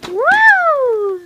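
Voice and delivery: high, loud whoop of delight; excited cheer. Real, not acted. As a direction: cheer the trick like a fan. Cheering a trick outside.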